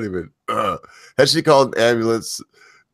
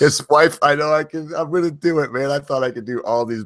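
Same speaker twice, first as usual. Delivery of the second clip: laughing; 6 semitones higher than usual. Real, not acted. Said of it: "without laughing".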